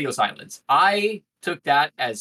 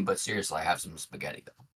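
low, much softer deadpan; softer, lower straight-man delivery; 9 semitones lower, 9 dB quieter than usual. Shown as a demonstration. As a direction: drop into the serious man, quiet and low. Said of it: "how to be the serious man".

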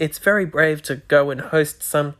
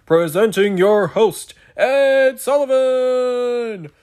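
higher, louder, slow drawn-out showman announcement; booming TV announcer; 8 semitones higher, 5 dB louder, much slower than usual. Shown as a demonstration. Podcaster demoing a host voice, slow grand announcer intro. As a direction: present the star like an old variety show announcer. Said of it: "voice you use for hosting matters".

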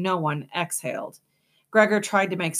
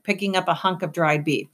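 usual speaking voice

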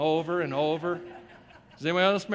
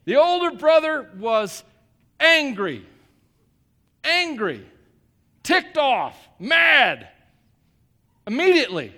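angry emphasis; 4 semitones higher, 7 dB louder, slower than usual. Acted. Preacher stressing the brother's anger.

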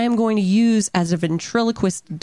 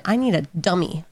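ordinary talk, nothing marked

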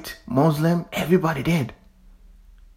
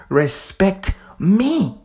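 slow emphatic; 4 semitones higher, much slower than usual. Real, not acted. Slow drawn-out demand.

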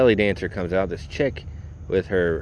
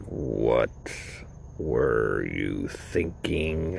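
low disbelief; 8 semitones lower, 6 dB quieter, much slower than usual. Retold or imitated. Glaring at mom and grandma.

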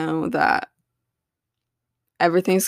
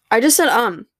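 frustrated; 5 semitones higher, 6 dB louder than usual. Real, not acted. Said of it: "I'm raging right now".

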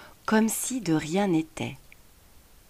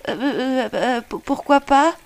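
put-on high child voice, stammering; a flustered little boy stammering; 6 semitones higher, 3 dB louder than usual. A narrator's reading. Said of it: "balbutia Sacha".

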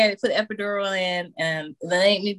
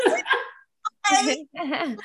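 laughing disbelief; 10 semitones higher than usual. Retold or imitated.

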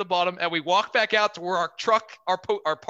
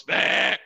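goat bleat; 6 dB louder than usual. Retold or imitated. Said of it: "the goat looks at him and goes".

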